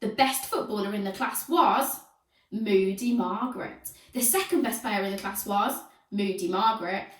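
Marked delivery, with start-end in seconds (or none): none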